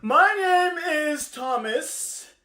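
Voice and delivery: high, loud, slowly stretched words; proclaiming bird. Acted. Bird declares new name to the others, high proclamation. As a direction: announce your new name grandly.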